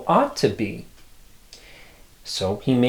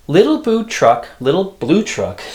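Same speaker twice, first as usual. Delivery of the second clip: sing-song refrain; 5 semitones higher, 5 dB louder than usual. Acted.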